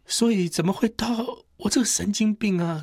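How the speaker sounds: 提高嗓门自责，声音拔高; 懊悔地高声质问自己; self-reproach. Real, not acted. 自责激动. (激动地责问自己)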